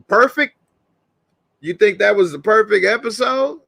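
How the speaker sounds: high, incredulous disbelief; high mock astonishment; surprised. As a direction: act shocked at a perfect score.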